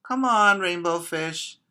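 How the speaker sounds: high, loud playful call; excited calling voice; fish friends calling. Acted. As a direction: call out to a friend across the reef. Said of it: "they would call".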